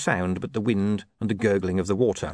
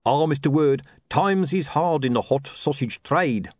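higher, drawn-out lament of a hard-up hawker; sorry-for-himself hawker, pitched up; gloomy sausage seller; 6 semitones higher, 3 dB louder than usual. Acted. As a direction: play a down-on-his-luck street trader.

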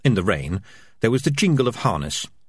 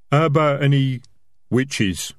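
higher, louder opening, then dropping; lightly voiced highwayman querying; working through a list; 6 semitones higher, 4 dB louder than usual. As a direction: go down a checklist of suspects. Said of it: "sounded as though he was working through a list".

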